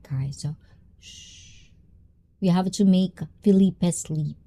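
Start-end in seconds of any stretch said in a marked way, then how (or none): 1.0-1.7 soft shush. Real, not acted. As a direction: shush the room quietly. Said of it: "We cannot shout anymore".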